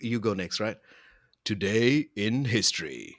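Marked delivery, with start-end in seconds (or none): 1.4-3.1 slow, grand segment-intro read; mock announcer. Host announcing the today-in-history segment in an announcer voice. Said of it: "Let me say it".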